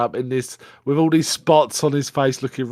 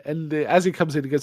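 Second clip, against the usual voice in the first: ordinary talk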